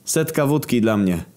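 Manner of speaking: insistent request; demanding. A narrator's reading. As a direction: demand a drink at the bar. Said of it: "zażądał".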